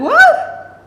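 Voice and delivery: very high, loud shocked What?. Retold or imitated. Reacting to the man's confession, shocked high What?. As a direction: gasp it out in disbelief. Boyfriend says he can't behave.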